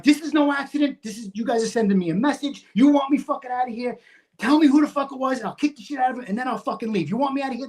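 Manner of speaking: high-pitched, heated retold accusation; angry confrontation. Acted quarrel, accusing the probies.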